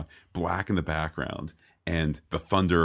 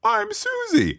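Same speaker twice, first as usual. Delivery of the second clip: very high, louder falsetto mock voice; mock French courtier; 7 dB louder than usual. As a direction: parody the courtiers in a squeaky falsetto. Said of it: "everyone's like".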